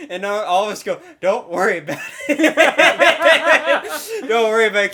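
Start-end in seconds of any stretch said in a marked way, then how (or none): 1.2-4.9 high, loud words breaking into laughter; helpless cackle through the words; retold laughing reassurance. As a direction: crack up while imitating a reassurance. Retold class laughing at the teacher.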